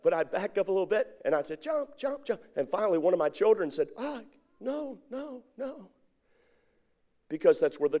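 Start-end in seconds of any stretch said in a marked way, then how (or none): 1.6-2.4 put-on high, light call to a child; imitated parental coaxing, pitched way up. Backed-up father urging his child, high coaxing call. 4.0-5.9 put-on small, high child's voice, soft; reluctant child. Child too distrustful to jump, high soft refusal.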